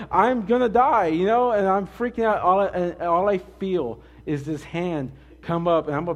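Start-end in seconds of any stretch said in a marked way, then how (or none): 0.1-1.1 mock panic. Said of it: "You know, like".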